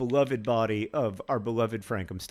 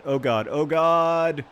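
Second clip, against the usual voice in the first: louder, strained oh god; groaning as the chance slips; anguished groan; 2 semitones higher, 6 dB louder, slower than usual. Real, not acted. Gamer watching his striker shoot, anguished.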